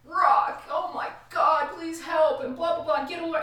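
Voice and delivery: high, loud frantic pleading; panicked husband. Retold or imitated. Husband begging his wife from jail, frantic and high. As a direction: beg loudly in a high panic. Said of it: "and he's just like".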